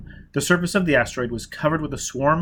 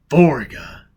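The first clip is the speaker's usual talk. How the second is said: a louder, strained single word; strangled voice; 2 semitones higher, 7 dB louder than usual. Acted. Foyle naming his enemy Vorga, strangled. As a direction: choke the name out. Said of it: "he said in a strangled voice".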